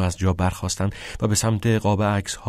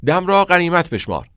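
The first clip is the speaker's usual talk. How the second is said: higher, louder pupil's answer; 9 semitones higher, 6 dB louder than usual. Acted. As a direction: give the translation like a star pupil. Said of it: "نابغهٔ زبان لاتین پاسخ داد".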